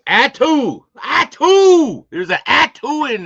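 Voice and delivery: high, loud startled cry of Atu; surprised yelp. Real, not acted. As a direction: yelp at the typo you just spotted. Spotting a typo on screen.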